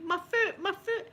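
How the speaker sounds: put-on high whimpering child, mildly done; mock crying child. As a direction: imitate the child's pained cry. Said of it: "she's crying".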